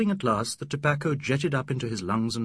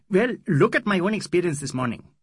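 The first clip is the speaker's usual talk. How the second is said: louder, higher, aggrieved opener; indignant friend; 7 semitones higher, 8 dB louder than usual. Acted. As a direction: bring up a fresh insult as proof. Mahmud Ali argues his point.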